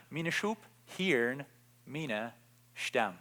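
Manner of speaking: preacher softly repeating Jesus to the congregation, slowed and hushed. Shown as a demonstration. Third repetition, dropping soft.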